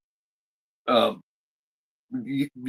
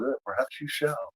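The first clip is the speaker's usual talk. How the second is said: much softer, almost breathed, knowing; sly Count reply; 3 semitones higher, 11 dB quieter than usual. Retold or imitated. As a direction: murmur it as a veiled threat. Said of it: "and he goes".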